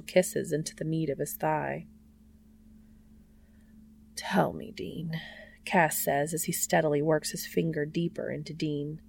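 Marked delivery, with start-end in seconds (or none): 4.1-5.2 unvoiced soft whisper. Cass coaxing Dean, soft whisper. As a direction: hush it close to him. Intimate hushed request.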